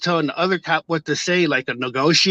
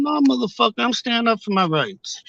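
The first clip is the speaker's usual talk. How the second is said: indignant reply; 6 semitones higher than usual. Retold or imitated. Retold angry reply to cops.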